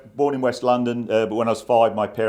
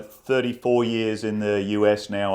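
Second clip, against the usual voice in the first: usual speaking voice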